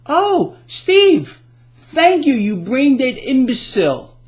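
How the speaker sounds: high, loud mock gratitude falling into an insult; exasperated, high-pitched sarcasm; sarcastic thanks. Real, not acted. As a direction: gush exaggerated thanks, then drop into scorn. Mock thanks to Treasury Secretary, then insult.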